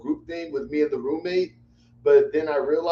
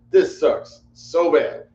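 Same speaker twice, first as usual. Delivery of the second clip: drawn-out frustration; 4 semitones higher, 6 dB louder, much slower than usual. Real, not acted. Frustrated at the wrestling result.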